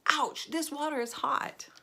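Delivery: high, drawn-out comic ouch; mock pained yelp. A narrator's reading. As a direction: yelp it high, as if scalded in play. Mock yelp at hot bath water.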